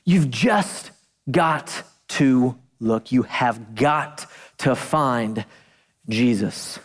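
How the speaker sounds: low, slow, every word weighed; slow emphatic preaching. Real, not acted. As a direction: lean in and drive it home slowly. Preacher emphasizing key point.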